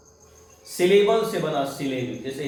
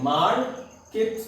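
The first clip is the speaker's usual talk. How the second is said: "Market" slow, higher, parted as मार, केट; two syllables; 5 semitones higher, much slower than usual. Shown as a demonstration. Teacher counting two pieces in the word, syllable by syllable. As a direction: pause inside "market" between its two syllables. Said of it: "तो दो टुकड़े हैं".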